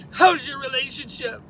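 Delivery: high, loud mock-tearful question; hammed-up high-pitched whine; imitated distraught woman. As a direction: demand it as the crying girl, imitated. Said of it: "crying her eyes out".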